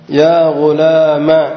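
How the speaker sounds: "يا غلام" very slow, ending on a short a; حذف الألف. Shown as a demonstration. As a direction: stretch it out but cut the final a short. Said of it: "بحذف الألف". ("يا غلام" ببطء بفتحة قصيرة دون ألف)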